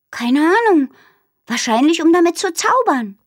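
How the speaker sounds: put-on a brainy little girl showing off facts; Minnie, know-it-all. Minnie lecturing Aru about her tummy, chirpy know-it-all.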